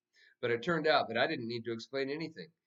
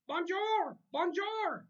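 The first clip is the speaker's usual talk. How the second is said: very high squawking bird voice; mynah bird; much slower than usual. A narrator's reading. The mynah bird greeting everyone in French, high bird squawk. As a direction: squawk it like a parrot. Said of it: "Uncle Feather said over and over".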